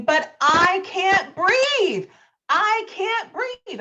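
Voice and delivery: slow, high pleading gasp of a woman who can't breathe. Retold or imitated. Grandmother pleading with police, high, slow and desperate. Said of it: "what you hear is Miss Dawson saying".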